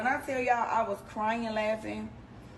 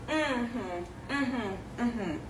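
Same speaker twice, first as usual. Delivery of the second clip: imitated suspicious murmurs; much slower than usual. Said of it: "the little Chinese people was like".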